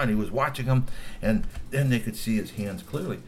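usual speaking voice

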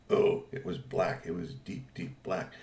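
soft, low, slow hushed admiration; hushed awe. A narrator's reading. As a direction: savour the colour. Awed description of bike.

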